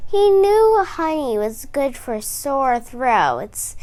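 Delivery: ordinary delivery, nothing marked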